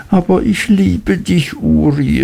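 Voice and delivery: high, imploring cry of love; high Mrs Heep begging her son; mother crying out. Acted. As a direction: plead with him, clinging. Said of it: "rief Misses Heep".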